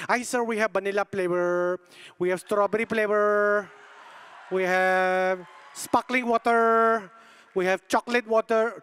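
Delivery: put-on Filipino accent listing options with stretched endings; mock Filipino service voice; cute Filipino lady. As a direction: imitate a polite Filipino server reciting flavours. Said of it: "This cute lady goes".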